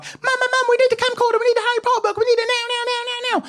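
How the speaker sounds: put-on very high-pitched, breathless-with-excitement kid; imitating an over-excited eleven-year-old; excited child. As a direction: pester mum in a squealing little voice. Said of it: "I was so excited".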